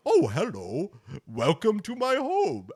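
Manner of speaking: mock-polite game NPC greeting; lightly hammed-up polite NPC welcome. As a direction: play the cheery NPC homeowner, a little stretched. NPC voiced in game story.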